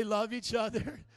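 loud, slow, emphatic rallying cry; like you mean it. Shown as a demonstration. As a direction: belt it out with a smile for the crowd. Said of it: "Now say it like you mean it. Smile".

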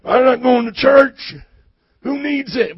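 loud, high hammed-up country accent. Preacher imitating church skipper's refusal, mock country accent. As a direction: holler it like mock country folk. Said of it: "They talk like that".

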